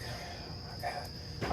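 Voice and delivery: breathless slow whisper under the breath; whispered aside. Retold or imitated. Reacting to the woman asking if his hotel is nice, whispered slowly. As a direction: mouth it to yourself in disbelief. Inner reaction to her question.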